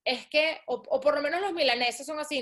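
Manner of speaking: ordinary delivery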